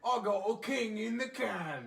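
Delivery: slow mock Cockney bloke; Cockney accent. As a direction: ham up a Cockney lad, unhurried. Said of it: "talk in a cockney accent".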